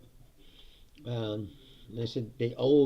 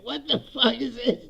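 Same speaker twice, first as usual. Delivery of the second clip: higher, outraged what-the-hell; shocked disgust; 12 semitones higher, 4 dB louder than usual. Retold or imitated. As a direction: recoil at the taste, pitched up. Disgusted at the vinegar ribs.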